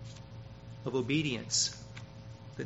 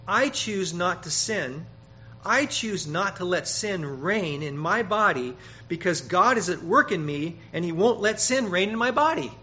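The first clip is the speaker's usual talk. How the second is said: firm, louder believer's voice; voicing a believer; 4 semitones higher, 7 dB louder than usual. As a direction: speak it as your own resolve. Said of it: "then you'll talk like this".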